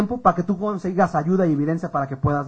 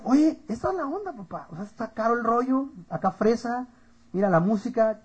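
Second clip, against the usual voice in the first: soft, higher mock wonder; impressed clubgoer; 4 semitones higher, 6 dB quieter than usual. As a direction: imitate being wowed by the club. Se imita fascinado en el antro. (asombro imitado, en voz bajita)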